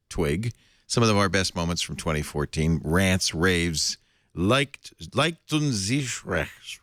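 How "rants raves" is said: normal talking voice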